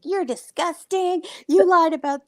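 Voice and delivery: high-pitched imitation of someone losing it; retold outburst. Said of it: "lost it at her".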